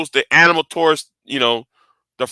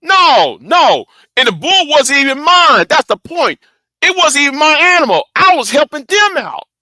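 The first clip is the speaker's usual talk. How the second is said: high-pitched exclaiming, insistent; indignant protest; 11 semitones higher than usual. Real, not acted. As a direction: protest your case in a raised, much higher voice. Indignant protest about the bull.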